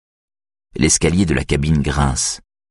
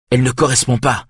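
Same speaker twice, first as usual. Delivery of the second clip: raised, urgent and higher; frustrated at the wrong key, raised voice; loud voice; 7 semitones higher than usual. Acted. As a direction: call it out over the storm. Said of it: "dit Björn d'une voix forte".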